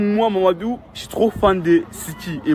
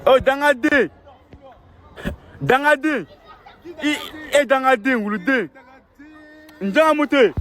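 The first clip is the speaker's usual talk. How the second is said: excited shout; 12 semitones higher than usual. Real, not acted. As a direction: shout the name out with joy. Excited chant of a name. (cri excité)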